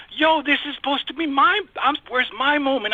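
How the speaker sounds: high-pitched, retold protest at being left out; frustrated complaint. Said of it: "all my frustration".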